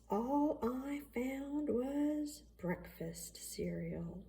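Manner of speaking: slow, soft, flat disappointment; drawn-out glum punchline; deadpan letdown. Acted. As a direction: let the letdown sink in slowly. Disappointed child reports cereal box.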